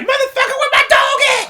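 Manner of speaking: high shrieking angry woman; angry girl's voice. Retold or imitated. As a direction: shriek like a furious girlfriend. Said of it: "to hear some girl's voice".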